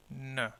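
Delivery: N as a short, soft "nuh" on its own; isolated unstressed syllable. Shown as a demonstration. Teacher showing the syllable after the break, isolated and unstressed. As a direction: drop the final N syllable, soft and separate. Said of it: "Here is the syllable break".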